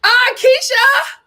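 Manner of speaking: drawn-out, high, delighted call of a name; light up at seeing her name; excited greeting. Real, not acted. To a chat commenter, a slow delighted high greeting.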